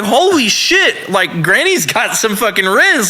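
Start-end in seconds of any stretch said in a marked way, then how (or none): 0.0-1.1 slow, high-pitched retold shock; astonished. Bouncer watching granny grab the headliner, astonished. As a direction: draw it out in amazement.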